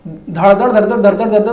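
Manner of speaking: a light mock of a rapid-fire YouTuber; rattling fast talker. Vlogger mocking a fast-talking tech YouTuber, rapid rattle. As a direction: rattle off the syllables like a machine gun. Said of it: "गुरु जी, भाई वो तो".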